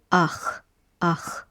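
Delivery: ach with a breathy throaty ch; ach blend. Shown as a demonstration. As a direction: say ah then rasp ch. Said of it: "that's how you make the last sound here".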